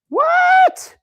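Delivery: high, loud hammed-up what; mock surprise shout. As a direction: shout it like you can't believe it. Hyped surprise at light side.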